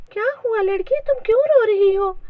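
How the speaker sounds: high fairy voice, gently enquiring; lightly voiced high-pitched fairy; kindly fairy. A narrator's reading. Fairy asks crying girl what happened, gently and high.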